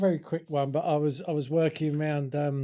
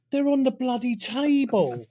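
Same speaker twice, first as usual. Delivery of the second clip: high, louder, slow exasperated mother; exasperated mum; 9 semitones higher, 4 dB louder than usual. Retold or imitated. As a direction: snap it like a mum at the end of her tether. Mother snaps at the kid.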